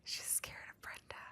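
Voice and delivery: very soft conspiratorial whisper; barely audible playful whisper. Pretend. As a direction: whisper it like a secret. Joins friend's mock-secret whispering.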